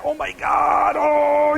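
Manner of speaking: high shocked cry of horror; panicked gasp. Retold or imitated. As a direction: yelp it in mortified panic. Panics at who is calling.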